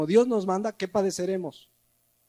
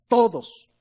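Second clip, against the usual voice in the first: "todos" set apart, higher and firmer; emphatic "todos"; 3 semitones higher, 4 dB louder than usual. Real, not acted. Preacher rebutting anyone claiming exception, emphatic stress on "todos". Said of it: "Vean que dice todos".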